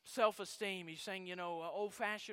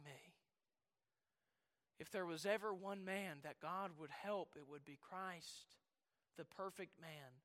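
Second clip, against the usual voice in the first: hushed preaching; 2 semitones lower, 5 dB quieter than usual. Acted. Preacher dwelling on the cross, soft and solemn. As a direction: drop to a grave hush.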